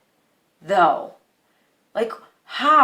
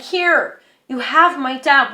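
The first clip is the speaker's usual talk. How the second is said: raised, high-pitched retort; acted-out quarrel, higher and louder; exasperated outburst; 5 semitones higher, 4 dB louder than usual. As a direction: throw it back at her, fed up. Handing over keys in retold quarrel.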